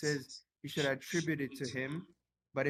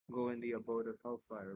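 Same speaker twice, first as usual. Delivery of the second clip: ordinary delivery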